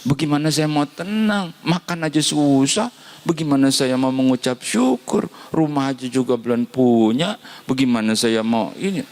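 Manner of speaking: lightly voiced grumbling poor man; mock complaining believer. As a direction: whine about having nothing.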